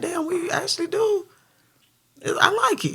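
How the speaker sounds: rising excitement, surprised at how good it sounds; thrilled realization. Retold or imitated. Rapper recalling the studio moment the duo clicked, excited and high.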